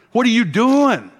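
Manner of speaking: mock a harsh church scold, hammering her; imitating a scolding. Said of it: "I would have hammered her".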